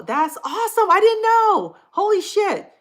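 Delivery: loud, very high excited outburst; real high-pitched excitement; thrilled surprise. Real, not acted. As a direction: squeal it out, amazed and delighted. Delighted discovery of shared hobby.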